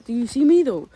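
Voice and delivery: cheeky attention call; playful call. Pretend. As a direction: sing it out to be noticed. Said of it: "I don't know why I say that".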